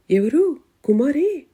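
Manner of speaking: startled, loud high gasp of a question; shocked disbelief, blurted out; stunned. A narrator's reading. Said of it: "అని నిర్ఘాంతపోయింది".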